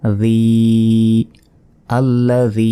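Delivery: জি and আল্লাযী with the final long "ee" held on a level note; one-second elongation. Shown as a demonstration. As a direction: stretch the end of আল্লাযী for a full second, Quran-teacher style. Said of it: "এখানে কিন্তু এক আলিফ টান হবে".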